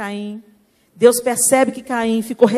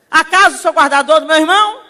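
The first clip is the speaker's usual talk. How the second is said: loud, higher, cheeky talking back; insolent retort; 7 semitones higher, 9 dB louder than usual. Retold or imitated. Cain answers God rudely, loud and insolent. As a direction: throw the question back at God. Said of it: "resposta mal criada pra Deus".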